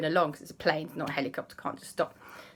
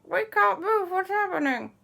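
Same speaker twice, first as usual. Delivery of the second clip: high, strained group alarm; frozen stiff; 13 semitones higher than usual. Acted. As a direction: ask in panic with a stuck mouth.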